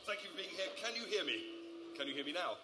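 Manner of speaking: ordinary talk, nothing marked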